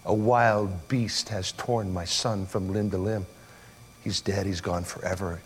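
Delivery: low, slow mourning; understated grief. Retold or imitated. Father learning of his son's death, low slow grief. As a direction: let the loss weigh down each word, slowly. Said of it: "he began to mourn and to weep".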